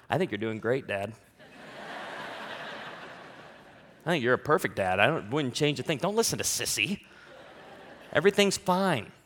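put-on low, soft voice of a child reassuring his dad; son's voice. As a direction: play the sweet son, softly.

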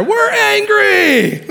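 hammed-up angry crowd member; mock angry yell. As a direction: bellow it like a clueless mob. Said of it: "didn't even know why they were there".

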